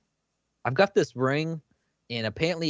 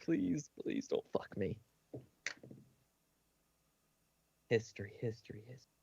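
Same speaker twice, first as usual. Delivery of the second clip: pleading under breath; 3 semitones lower, 14 dB quieter than usual. Real, not acted. Player begging the dice before a roll, soft and pleading. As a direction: beg the dice quietly. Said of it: "player begging dice roll".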